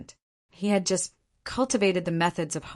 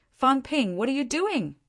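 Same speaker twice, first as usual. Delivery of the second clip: shouting through the door; 8 semitones higher than usual. A narrator's reading. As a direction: yell his name through the door. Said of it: "banged on the door and shouted".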